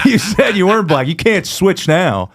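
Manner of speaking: high, laughing objection; mock protest. Comedian answering the heckler who switched, mock protest.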